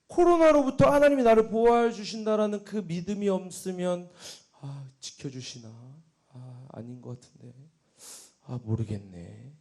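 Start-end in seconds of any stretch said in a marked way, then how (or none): 4.6-9.5 slow, low, soft uncertainty; wavering doubt. Retold or imitated. Doubting whether God protects, wavering and muted. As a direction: mumble doubtfully to yourself, drawn out. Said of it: "그렇게 갈팡질팡하면요".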